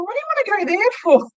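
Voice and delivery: high, quick mock-puzzled question; incredulous cab driver. Cab driver questions passenger's destination, incredulous.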